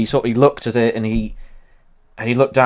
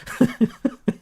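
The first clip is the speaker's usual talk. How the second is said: high giggle; 13 semitones higher, 4 dB quieter than usual. Real, not acted. Laughing at own impression.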